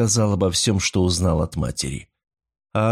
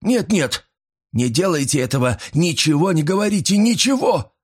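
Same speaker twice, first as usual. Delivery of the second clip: high-pitched, agitated begging; frantic plea; 8 semitones higher than usual. A narrator's reading. Old man begs Paul to tell nobody, frantic. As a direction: beg him in mounting panic.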